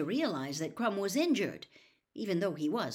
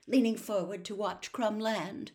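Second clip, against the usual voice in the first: plain reading voice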